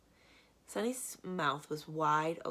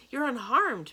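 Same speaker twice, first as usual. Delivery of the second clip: relieved cry; 8 semitones higher, 10 dB louder, slower than usual. A narrator's reading. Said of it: "Mister Poe cried".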